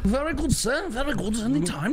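high-pitched, eager mock butler; hammed-up butler eager to please; servile butler. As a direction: put on an obliging manservant voice. Plays butler Reginald answering master.

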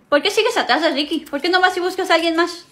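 usual speaking voice

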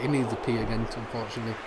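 usual speaking voice

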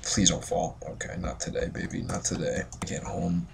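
breathless whispered pleading, barely voiced; desperate whisper. Real, not acted. Gamer pleading with the bridge, in a tense whisper. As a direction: beg the game under your breath. Desperate during perilous jump.

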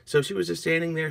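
ordinary delivery, nothing marked

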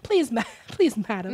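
high mock English politeness; hammed-up English accent, giggling; badly faked English gentleman. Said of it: "how to do an English accent".

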